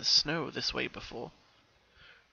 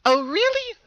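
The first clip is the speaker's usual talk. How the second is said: chuckling; 9 dB louder than usual. Acted. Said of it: "the voice chuckled".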